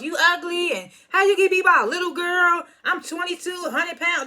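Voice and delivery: high, mocking taunt. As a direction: needle her from up high. Anna taunting from the couch.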